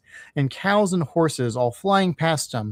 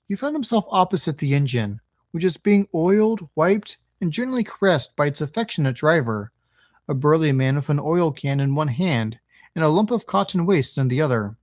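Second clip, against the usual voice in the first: ordinary delivery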